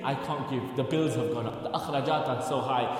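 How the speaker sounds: low, soft whispering doubt; voice of shaitan. Retold or imitated. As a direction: murmur the excuses like a tempter. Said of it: "that shaitan, telling you".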